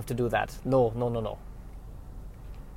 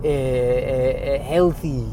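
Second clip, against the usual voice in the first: put-on French Canadian accent; slower than usual. Trader defends trail mix.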